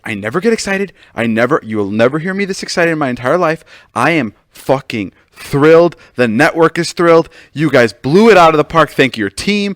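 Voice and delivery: hammed-up thrilled executive; imitating excited boss. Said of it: "He was so excited".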